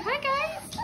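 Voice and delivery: high squeaky dog greeting. Pretend. Owner voicing the husky greeting puppies, high and squeaky. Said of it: "Kenai's like".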